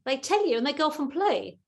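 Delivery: ordinary delivery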